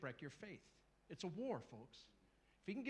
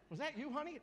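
wife's voice; 10 semitones higher than usual. Acted. Wife asks husband.

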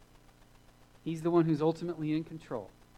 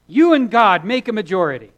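booming, high preacher shout; preacher's proclamation; 6 semitones higher, 19 dB louder than usual. Retold or imitated. Quoting a university preacher to the congregation, loud proclamation. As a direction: belt it out like an impassioned preacher. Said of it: "there was a preacher, he said".

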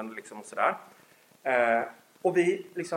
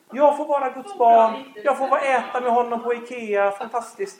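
joyful; 8 semitones higher, 9 dB louder than usual. Retold or imitated. Joyful child of God.

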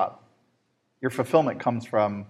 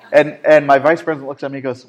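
normal talking voice

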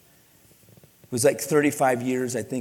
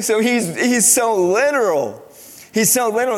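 amused; 9 semitones higher, 6 dB louder than usual. Real, not acted. Amused exasperation about son.

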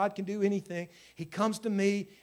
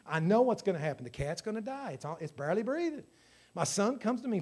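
ordinary delivery

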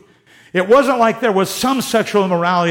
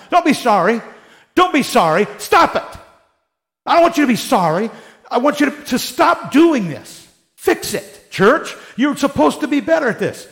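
exasperated scolding; 6 semitones higher than usual. Retold or imitated. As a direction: demand they stop and fix it. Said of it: "he's talking like this the whole time".

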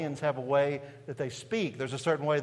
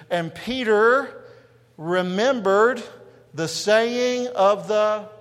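loud, higher, drawn-out scripture reading; slow congregational reading; 6 semitones higher, 9 dB louder, much slower than usual. Real, not acted. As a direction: lead the church loudly, stretching each phrase. Leads congregation reading verse aloud.

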